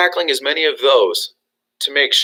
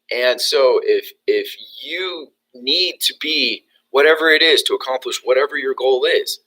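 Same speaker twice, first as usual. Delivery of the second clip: ordinary talk, nothing marked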